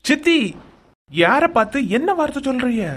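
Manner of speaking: indignant nephew scolding his aunt; angry rebuke. Acted. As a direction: demand an answer, high and hard. Nephew confronts aunt's insult.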